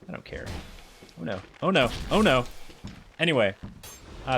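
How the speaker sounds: normal talking voice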